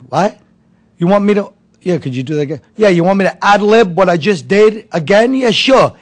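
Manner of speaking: loud, high, disbelieving outburst at the film crew; an old comic exploding at the crew, loud and high; incredulous yelling. Retold or imitated. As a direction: yell it like you can't believe what they're asking. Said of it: "He starts screaming".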